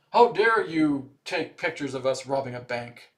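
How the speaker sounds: loud, hammed-up outrage; mock indignant robber. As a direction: play the caught robber, offended. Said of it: "That's what it reminds me of".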